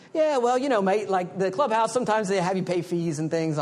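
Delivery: high-pitched mock-British roommate; terrible British accent. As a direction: badly fake a British accent, high and a bit loud. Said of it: "that's probably a terrible British accent".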